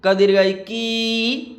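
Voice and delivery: "की" stretched on a level, sustained ee; long vowel. Shown as a demonstration. Teacher spelling out the long ee sign, final "की" drawn out. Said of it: "इसको ज़्यादा खींचना है".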